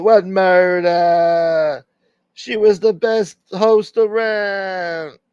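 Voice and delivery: high, loud, long-drawn mock protest; hammed-up, drawn-out yell; mock outcry. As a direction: cry it out high, stretching the words slowly. Jokey outburst defending the host.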